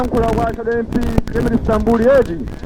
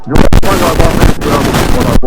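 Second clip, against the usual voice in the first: loud rally speech; 3 semitones lower, 6 dB louder, faster than usual. Acted.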